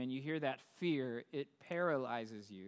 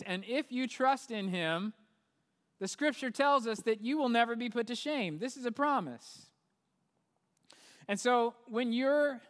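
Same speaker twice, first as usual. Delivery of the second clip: raised, louder, driving sermon voice; preaching at full force; 5 semitones higher, 5 dB louder than usual. Acted. Pastor urging listeners to share hope, raised preaching voice. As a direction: lift the congregation with the promise.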